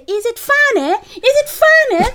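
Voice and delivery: high, slow scolding question; stern teacher scolding. Retold or imitated. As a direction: demand it sharply, drawn out. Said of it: "and she'd say".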